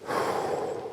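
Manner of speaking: soft breathy sigh; whispered sigh. Real, not acted. Whispered sigh after fog.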